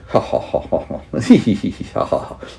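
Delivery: soft breathy chuckles; chuckling demo. Shown as a demonstration. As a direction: chuckle quietly to yourself. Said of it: "Chuckle is like laughing".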